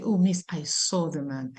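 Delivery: soft, low "Oh, miss, I saw the man"; students answering softly. Retold or imitated. Pupils replying to their teacher, soft and low. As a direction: answer like a shy pupil, quietly. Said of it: "And they would say".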